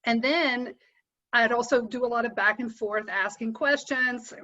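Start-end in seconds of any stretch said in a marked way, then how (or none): none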